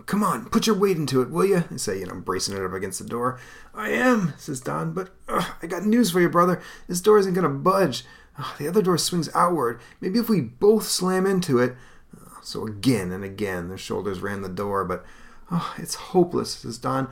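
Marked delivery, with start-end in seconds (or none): 0.1-1.6 much higher, pushing voice; straining hero. Acted. Brothers prying the door, urging voice. 3.7-11.8 much higher, frustrated brotherly voice; young hero. Acted. Don telling Hank the door won't budge, frustrated. 15.5-16.5 much higher, hammed-up hopelessness; mock despair. Don giving up on the door, mock despair.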